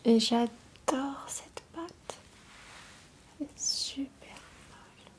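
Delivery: voix chuchotée douce et proche; chuchotement ASMR. Acted. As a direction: chuchoter tout près du micro. ASMR whisper with play-dough. (murmure ASMR)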